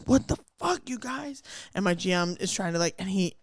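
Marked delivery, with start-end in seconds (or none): 0.0-1.4 retold yell. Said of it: "I'd yell, and I'd scream".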